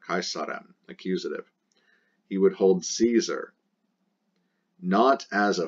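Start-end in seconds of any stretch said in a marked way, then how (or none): none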